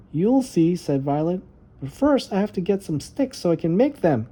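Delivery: put-on high, eager child's voice; little girl voice. Little Violet telling her brother about the dark, high and earnest. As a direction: play the small sister talking herself brave.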